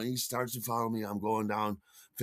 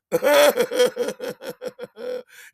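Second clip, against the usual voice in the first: high, loud cackling laugh; a long burst of high hooting laughter; drunken belly laugh; 11 semitones higher, 9 dB louder than usual. Retold or imitated. Recalls drunken friends' hilarity.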